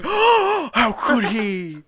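drawn-out hammed-up disbelief, like a shocked crowd; mock shocked crowd. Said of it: "you just hear the crowds going".

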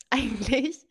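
laughing. Retold or imitated. Laughing at herself.

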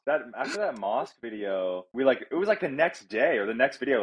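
ordinary delivery

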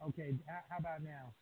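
distant, faint voice off the microphone; speaking far from the microphone; far from mic. Real, not acted. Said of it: "No, it still sounds like, uh, you're far away".

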